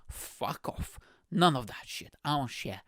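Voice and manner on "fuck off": voiceless, hushed brush-off; whispered dismissal. Acted. Refusing to share mi casa, whispered dismissal. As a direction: whisper it as a comic put-down.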